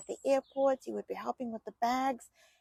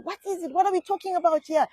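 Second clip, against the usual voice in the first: high-pitched mock-panicked questioning; retold panic; 6 semitones higher, 6 dB louder, faster than usual. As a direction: act out a racing, worried mind. Voicing her panicked imagined questions.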